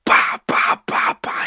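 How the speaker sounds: sharp percussive bah sounds; voiceless thumps in a quick series; imitated banging. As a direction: mimic fists hitting the bathtub walls. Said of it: "hitting the walls on his fucking bathtub".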